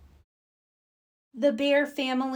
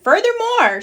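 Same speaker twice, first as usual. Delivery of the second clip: firm; 12 semitones higher, 6 dB louder than usual. Acted. Mama laying down rule.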